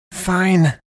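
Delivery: high, irritated concession; spat out. A narrator's reading. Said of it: "Sam spat".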